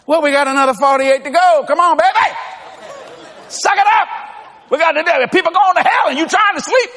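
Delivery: rally a worn-out partner; pushing on. Retold or imitated. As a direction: pastor urging a sleepless staffer to keep going. Urging tired Fritz in the truck.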